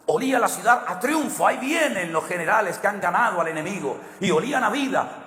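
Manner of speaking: rising, swelling preacher's cry; impassioned sermon delivery; preaching at full force. Acted. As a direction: paint the victory parade with mounting fervor. Preacher builds the triumph scene.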